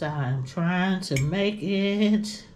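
groaning through a full stomach; stuffed full. Real, not acted. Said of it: "I'm forcing this food".

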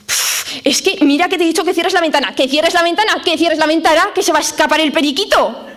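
high, fast exasperated nagging; fed-up scolding. Acted. Speaker acting out nagging someone about the open window, high and fast exasperation. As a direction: snap at them for leaving the window open again. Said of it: "en la voz es".

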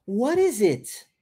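"What is it" spoken slowly, falling on "is"; slow model. Shown as a demonstration. Teacher slows the question for repetition. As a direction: stretch each word out slowly for learners. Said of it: "let's repeat".

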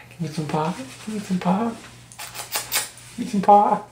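high, soft coaxing voice to a cat. Real, not acted. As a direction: coo it to the kitty, drawing out paw. Talking to cat.

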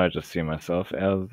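normal talking voice

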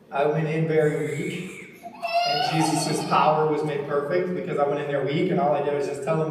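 rising into a loud preaching cry. Acted. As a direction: build it up like a sermon climax.

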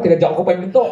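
ordinary talk

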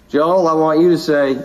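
ordinary talk

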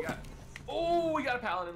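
high, loud thrilled shout; excited. Real, not acted. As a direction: shout it out with delight. Thrilled at weapon find.

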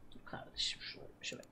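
hushed whisper. Real, not acted. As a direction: whisper it so no one hears. Hushing the friend mid-game.

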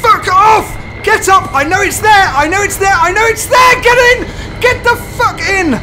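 high, loud frantic yelling; frantic shouting at the monster; panicked screaming. Real, not acted. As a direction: scream at the screen in terror.

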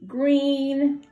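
high drawn-out green; singsong sign word. Shown as a demonstration. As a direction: sing it out for kids. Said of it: "You do it with me".

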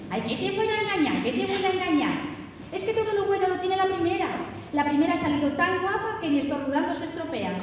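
high-pitched mock mother; imitating mother. Mother answers father's joke about their daughters, high imitated mother. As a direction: raise the pitch into an emphatic motherly protest. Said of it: "añadía nuestra madre".

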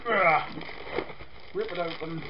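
ordinary talk

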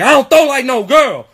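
high-pitched indignant comeback; competitive dad retort. Retold or imitated. Competitive dad answering his son's taunt, indignant. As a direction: imitate a riled father refusing to lose. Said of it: "he starts throwing it as hard as he can".

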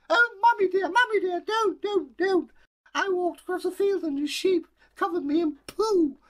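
very high, loud crying plea; a child begging not to be punished; pleading little girl. Acted. Girl pleads with angry mother, high and crying.

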